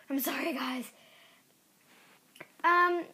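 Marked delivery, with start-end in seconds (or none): 0.1-0.9 whispering. Real, not acted. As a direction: breathe the words out. Whispered apology.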